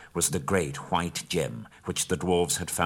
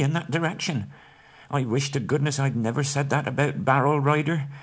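much higher, anxious hobbit voice; worried Bilbo; 8 semitones higher, 5 dB louder than usual. A narrator's reading. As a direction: fret over his mistake.

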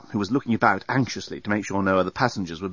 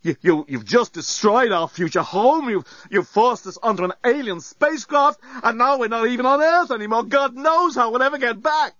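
high-pitched, panicky rant climbing higher; histrionic; 13 semitones higher than usual. A narrator's reading. Dan blaming Nettie for their ruined future home, histrionic. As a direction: work up into hysterical accusation. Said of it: "Dan had hit histrionic mode".

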